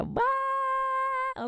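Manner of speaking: high full-body baby shriek imitation; mock baby scream. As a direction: stretch out and squeal like the baby. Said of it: "sträckte ut sig och bara skrek såhär".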